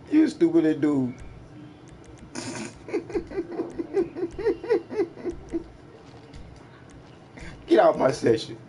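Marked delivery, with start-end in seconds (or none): 2.3-5.6 long high-pitched mocking laugh, soft. Real, not acted. Gloating over a beaten player, high mocking laugh. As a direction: cackle up high at the loser.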